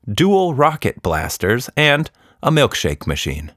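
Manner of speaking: plain reading voice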